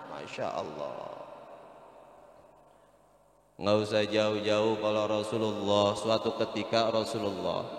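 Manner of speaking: low, hushed storytelling; soft story narration. Acted. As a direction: draw listeners in, low and gentle. Quiet storytelling of the Prophet. (kisah dituturkan pelan dan lembut)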